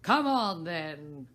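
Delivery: loud roar; the Queen roars for Alice to join, loud. A narrator's reading. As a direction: boom the order. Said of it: "roared the Queen".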